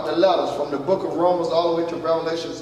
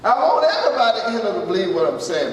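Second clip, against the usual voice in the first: normal talking voice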